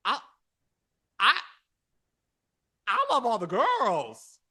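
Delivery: hammed-up loud protest of love; emphatic insistence. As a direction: belt it out with playful overemphasis.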